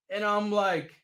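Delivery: normal talking voice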